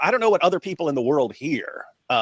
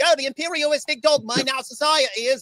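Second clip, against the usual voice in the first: very high mock Cockney accent; idiotic-sounding Cockney impression, pitched way up; Russell Brand; 13 semitones higher than usual. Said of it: "whatever Russell Brand is".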